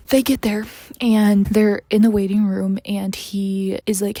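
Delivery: ordinary delivery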